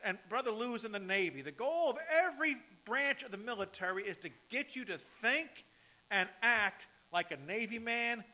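loud, fervent preaching. Acted. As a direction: preach it out with fire. Preaching at full force.

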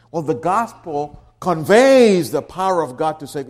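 "conveys" pushed out loudly; voiced objection with a loud, stretched "conveys"; stress on CONVEYS. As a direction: put the weight on "conveys". Second wrong reading, stressing conveys.

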